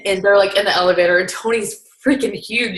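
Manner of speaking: ordinary talk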